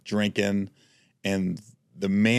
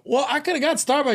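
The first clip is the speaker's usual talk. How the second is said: indignant squeak. Retold or imitated. Retold complaint to Casey.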